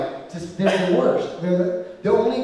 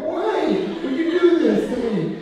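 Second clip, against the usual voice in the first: mock plea; 12 semitones higher, 3 dB louder than usual. Heckled entertainer pleading.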